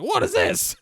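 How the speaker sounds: high, loud, stunned cry; shocked outburst. Retold or imitated. Rolf catching Sally with another man, stunned high cry. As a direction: blurt it out in disbelief.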